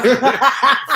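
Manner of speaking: high laugh breaking out; burst of laughter. Real, not acted. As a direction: laugh out loud. Laughs at the quote.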